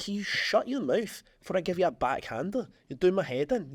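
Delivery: indignant telling-off. Acted. Loyal friend silencing someone who badmouths his mate, indignant. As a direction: warn off a gossip, lightly hammed-up. Defending a friend against a gossip.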